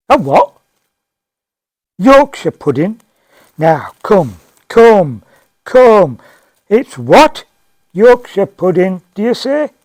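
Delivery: loud, high-pitched disbelief; incredulous. Acted. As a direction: echo it back in loud, scandalised astonishment. Diner disbelieves the waitress's answer.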